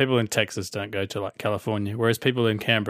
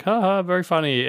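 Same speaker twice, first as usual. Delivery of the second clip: higher-pitched put-on social laugh line; mock amusement; 7 semitones higher than usual. Drinker imitating late-night party banter, mock amusement. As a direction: feign being entertained. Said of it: "don't have the energy to be like".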